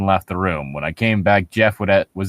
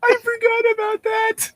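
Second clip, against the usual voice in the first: high-pitched, wheezing through tears of laughter; laugh-crying. Real, not acted. Reacting to the absurd fence jump, laugh-crying. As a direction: squeal it out while crying with laughter.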